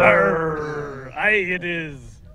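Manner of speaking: slow loud pirate Aye. Pretend. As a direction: growl it like an old sea dog. Talk Like a Pirate Day.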